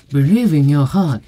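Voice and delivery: put-on foreign woman's accent from an advert; hammed-up foreign-accented Japanese; imitated foreign accent. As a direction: mimic a foreigner's accented ad slogan. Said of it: "外国人の女性が".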